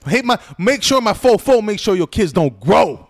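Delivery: [name]. Retold or imitated. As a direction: shout it like a diss-track outro. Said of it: "Yeah, [name]".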